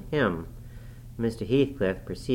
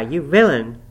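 loud, moderately raised cry of outrage; indignant cry; 4 semitones higher, 9 dB louder than usual. A narrator's reading. Nurse about to be silenced by a touch, loud indignant cry. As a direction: cry it loudly, a little less high-pitched. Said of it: "I began to cry".

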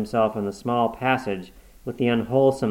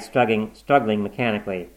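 ordinary delivery, nothing marked

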